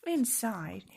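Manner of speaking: usual speaking voice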